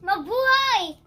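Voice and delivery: full-energy shout of Mabuhay; energetic greeting. Shown as a demonstration. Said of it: "That is not how you do it".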